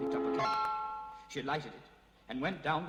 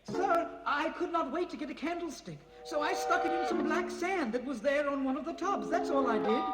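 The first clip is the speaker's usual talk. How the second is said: higher, lightly voiced girl; a hint of a nervous servant girl; 10 semitones higher than usual. Servant girl explains to master.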